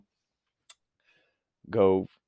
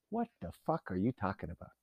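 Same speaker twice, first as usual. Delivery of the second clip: ordinary talk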